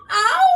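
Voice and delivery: high loud ow; wolf's nose slammed in door; howl of pain. Acted. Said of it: "howled the wolf".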